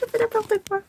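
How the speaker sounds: très aigu et plus doux, en riant; murmure rieur. Real, not acted. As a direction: se le redit pour elle-même, amusée. Répète en riant, plus bas. (aigu et doux, mi-rire)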